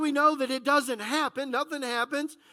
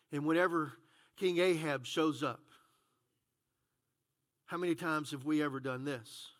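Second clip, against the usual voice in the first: usual speaking voice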